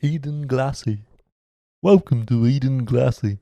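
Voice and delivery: high posh British welcome; mock posh English greeting. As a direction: announce it like a posh English host. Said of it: "Sounds very posh".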